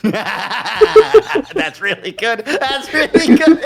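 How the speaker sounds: squealing with laughter, high; laughing. Real, not acted. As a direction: wheeze it out through laughter. Cracking up at the joke.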